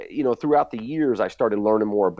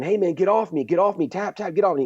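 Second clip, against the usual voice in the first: retold yell; 9 semitones higher than usual. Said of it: "So I start saying".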